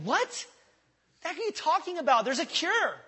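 high, loud incredulous outburst; worked-up disbelief. Acted. Preacher reacting to complaints about a cancer cure, incredulous shout. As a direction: cry out, astonished at the objection. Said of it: "getting a little emotional there".

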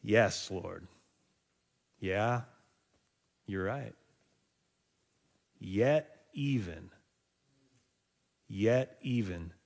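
soft, slow humble admission; humble quiet. Retold or imitated. Kneeling woman conceding to Jesus, soft and slow. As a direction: yield softly, then plead. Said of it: "She says".

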